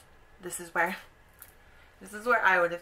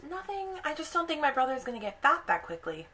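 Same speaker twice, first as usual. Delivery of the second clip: put-on much higher, innocent little girl voice, then quick; innocent Gretel; 8 semitones higher, faster than usual. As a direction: play it innocent, fast and high. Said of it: "Hansel and Gretel goes".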